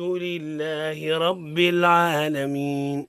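slow, measured Quran recitation. Acted. Teacher reciting الحمد لله رب العالمين for students. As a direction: recite each word evenly.

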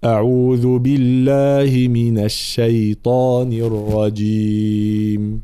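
slow melodic tilawa; Quran recitation. Acted. Starts recitation. (تلاوة مجودة)

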